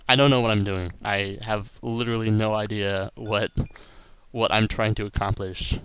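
ordinary delivery

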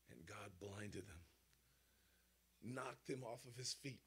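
hushed whisper. Acted. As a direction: drop to a whisper for the climax. Dramatic hush at the climax.

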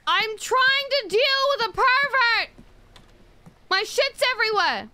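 high, loud exasperated shout; frustrated yell. Real, not acted. Attendant overwhelmed by the pervert and the mess, frustrated yell. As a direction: yell it at the top of your voice.

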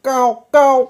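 two high, loud caws; high double crow caw; crow call. A narrator's reading. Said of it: "कावळा पुन्हा ओरडला".

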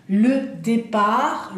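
"Le départ" said very slowly, with no final T sounded; drawn-out teacher model of "départ", with a silent T; slow, clear French "le départ" for students to repeat. Shown as a demonstration. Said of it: "T final no la pronunciamos".

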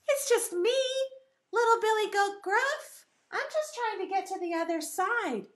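high, small billy goat voice; little goat. Acted. Little goat answering the troll, high little voice. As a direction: pipe up innocently like a little goat. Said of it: "the little billy goat said".

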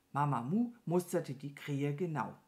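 ordinary delivery, nothing marked

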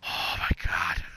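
breathless frightened whisper; whispered oh my God. Real, not acted. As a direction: gasp it out, shaken. Shaken after fright.

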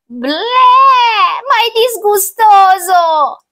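high, loud, drawn-out revulsion; disgusted child. Acted. Child hearing the witch's ingredients, loud high disgust. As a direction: whine it loudly in disgust.

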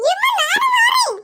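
put-on child shouting out. Little girl calling to her mother to join them at the sea. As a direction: shout so she hears you across the sand.

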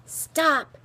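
high, loud yelp; sudden cry of protest; yell. A narrator's reading. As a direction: yell it while dodging a blow. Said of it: "to hear him yell".

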